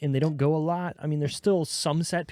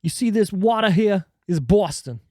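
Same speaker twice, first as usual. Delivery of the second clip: bad Boston accent, loud and high; hammy Boston accent; 5 semitones higher, 5 dB louder than usual. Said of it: "That was a bad Boston accent".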